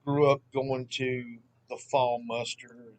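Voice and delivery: normal talking voice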